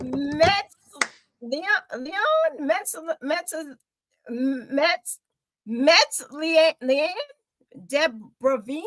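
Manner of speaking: trying out "Metz" and "Leanne" bit by bit, unsure, with pauses; stumbling name attempt. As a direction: sound out a hard name haltingly, guessing each part. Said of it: "Come on, drum roll, please".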